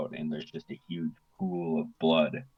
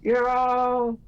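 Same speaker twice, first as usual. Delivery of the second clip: very high, slow, drawn-out scream; villain screaming as the church burns; 4 dB louder, much slower than usual. Acted. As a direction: scream it over the fire. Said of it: "And she screams".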